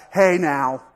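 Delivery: loud, drawn-out scolding, imitated; mock scolding. Parent to kid who spilled macaroni in the car, loud mock scolding. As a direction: come down loud on it in mock reproof. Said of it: "our attitudes are the worst".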